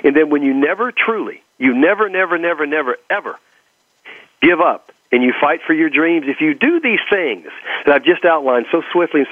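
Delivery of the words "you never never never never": ordinary talk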